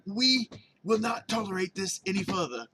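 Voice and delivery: mock British accent. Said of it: "Maybe that's why I went into the British accent".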